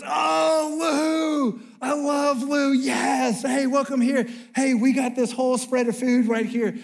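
loud, high, gushing welcome; overjoyed host shouting a warm welcome; excited host. Retold or imitated. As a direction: greet them like long-lost friends, booming. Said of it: "And he goes".

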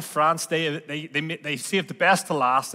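normal talking voice

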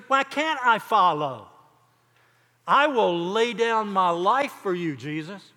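loud, emphatic pleading; insistent protest. Retold or imitated. Peter insists to Jesus he will follow, insistent protest. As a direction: protest your devotion with force.

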